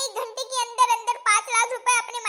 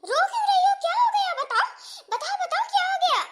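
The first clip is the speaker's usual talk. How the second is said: much higher, louder frantic questions; alarmed mother; 10 semitones higher, 5 dB louder, faster than usual. Acted. Mother on the phone frantically asks her crying daughter what happened. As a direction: press her to tell, in a panic.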